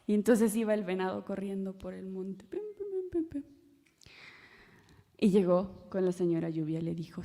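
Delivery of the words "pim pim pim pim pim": soft, high patter of hooves; running footsteps. Acted. Storyteller mimics the deer racing through the hills, high and soft. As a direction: tap out the deer's quick steps lightly.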